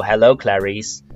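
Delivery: a hint of a low creepy Hello, Clarice; lightly voiced Hannibal Lecter line. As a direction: drop the pitch a little for the villain. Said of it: "in that voice".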